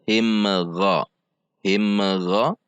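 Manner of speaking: "Him Gha" with a small vowel popping after m; bounced m. Shown as a demonstration. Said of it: "jangan sampai dipantul". ("Him Gha" dengan mim dipantul)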